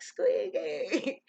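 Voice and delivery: high, loud mock children's cry; kids calling out. Kids gathered round her blurt out her nickname, loud high call. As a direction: sing out the nickname like excited kids.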